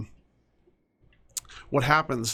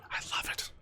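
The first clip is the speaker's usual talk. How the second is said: whisper; 11 dB quieter than usual. Real, not acted. Pleased with his worker's routine.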